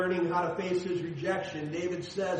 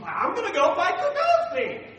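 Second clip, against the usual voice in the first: put-on high, loud eager young voice; a boy itching to fight; 14 semitones higher, 7 dB louder, faster than usual. Preacher voicing young David, eager and high.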